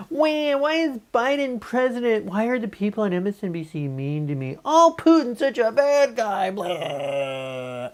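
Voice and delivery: high, slow, self-pitying whine; mock the person who feels everyone is mean to them; a high whiny poor-me voice; whiny victim voice. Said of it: "or it could be like".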